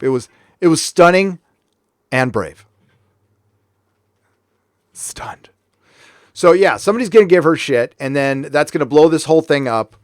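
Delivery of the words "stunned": breathy one-word whisper; barely voiced, like a last breath. Mocking the dying girl scene in a whisper.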